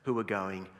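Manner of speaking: ordinary talk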